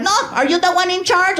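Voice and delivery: high-pitched Mexican mother confronting someone; bossy mom. Acted. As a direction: challenge him as the feisty mom. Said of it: "my mom was like".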